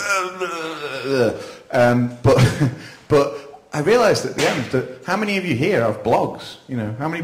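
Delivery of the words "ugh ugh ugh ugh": long, high, wordless groan sliding down; drawn-out "ugh" when lost for an answer; stumped groan. Retold or imitated. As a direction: imitate being stuck for words with a dragged-out groan. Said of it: "so I just kind of went".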